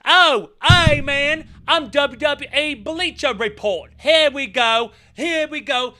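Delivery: high, loud, slow mock British presenter; mock British accent. Parodying a British Bleacher Report host in a high mock British accent. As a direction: drawl a mocking British YouTuber accent. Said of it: "British fuckfaces".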